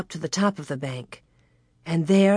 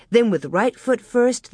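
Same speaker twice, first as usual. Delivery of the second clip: ordinary delivery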